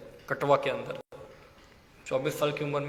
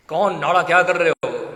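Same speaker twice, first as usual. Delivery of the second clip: loud, acted-out reprimand; mock scolding; 8 dB louder than usual. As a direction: demand an explanation loudly, playing at anger. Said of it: "और फिर नाड़ा को फटकारा". (नकली गुस्से में ज़ोर की फटकार)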